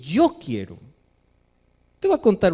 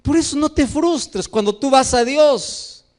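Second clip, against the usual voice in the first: ordinary delivery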